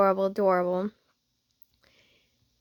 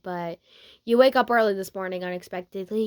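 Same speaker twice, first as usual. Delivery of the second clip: ordinary delivery, nothing marked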